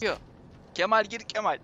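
urgent yell to a teammate; shouting. Real, not acted. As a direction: call out loud and high. Calling teammate in horror game.

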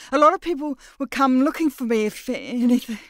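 a frightened bluff; nervous warning. Acted. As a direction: Mira threatening back in panic. Mira warns nervously.

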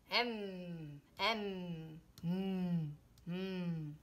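humming mm mm phonics sound; held mm like a phonics teacher; letter sound mm. Shown as a demonstration. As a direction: hum the M sound for the kids. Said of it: "this letter is".

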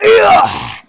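ordinary talk

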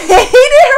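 high, loud cackle; loud burst of laughter. Real, not acted. Cracking up over the sister's constant face, loud laugh.

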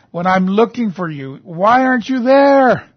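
anguished demand. Acted. Voicing David's complaint to God.

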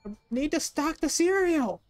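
high, frantic cry over shelf stocking; frustrated outburst. Pretend. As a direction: raise the pitch in mock panic. Frustrated outburst while stocking shelves.

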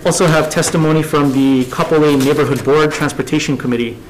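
normal talking voice

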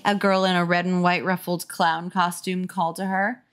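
usual speaking voice

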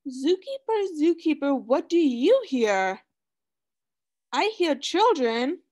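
sing-song storybook reading; reading to children. Acted. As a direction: read it like a picture book for kids. Reading picture book to class.

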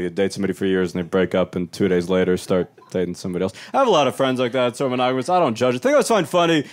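ordinary talk, nothing marked

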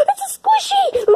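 high-pitched squeal of delight; gasp, then squeal it; thrilled. Acted. Character finding a squishy, thrilled.

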